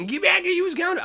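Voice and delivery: Father Rooster. Shown as a demonstration. Animator previewing the Father Rooster voice. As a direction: holler at a fleeing rascal. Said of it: "that type of voice".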